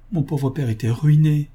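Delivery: plain reading voice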